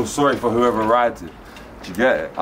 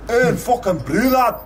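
put-on Scottish accent; 12 semitones higher than usual. Friend calls for five accents, so a hammed-up Scottish accent. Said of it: "Five accents, first one, go".

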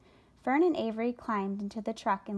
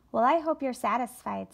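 sneer; 2 semitones higher, 4 dB louder than usual. A narrator's reading. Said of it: "sneered the rat".

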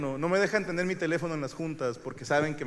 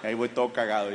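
ordinary talk

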